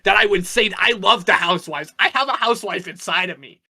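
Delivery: mock outrage, loud and high-pitched; Michael's loud offended protest. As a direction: play up being deeply offended, loudly. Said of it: "And Michael says".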